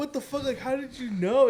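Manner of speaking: high-pitched mock panic; freaked-out retelling. Son asking his mom how she knew, freaked out. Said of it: "I was freaking out".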